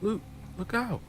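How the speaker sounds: put-on high, urgent girl's warning cry. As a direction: call out a desperate warning. Girl warns Luke in danger.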